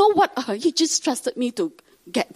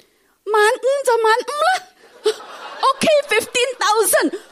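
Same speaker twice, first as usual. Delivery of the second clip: high, laughing retelling of giving in; 12 semitones higher, 3 dB louder than usual. Retold or imitated. Teller laughing as she retells the husband agreeing. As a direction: giggle through the words in disbelief.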